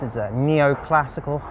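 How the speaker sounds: usual speaking voice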